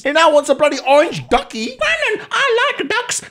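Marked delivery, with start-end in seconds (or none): 1.8-3.2 put-on high, slow baby voice. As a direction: coo like a toddler. Baby wants a duck.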